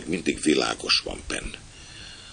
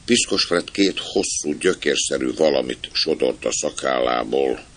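ordinary delivery

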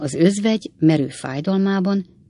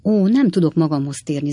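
ordinary delivery, nothing marked

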